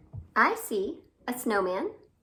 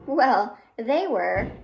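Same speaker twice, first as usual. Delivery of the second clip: usual speaking voice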